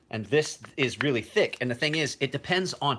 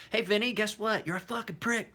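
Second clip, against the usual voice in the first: teasing, pitched up, joking jab; mock insult; 10 semitones higher than usual. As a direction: rib him playfully, pitched high. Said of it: "No, I'm just kidding".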